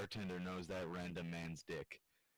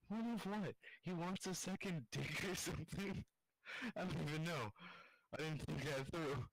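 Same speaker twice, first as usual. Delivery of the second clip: giggly; 8 semitones higher than usual. Real, not acted. Laughing at own joke.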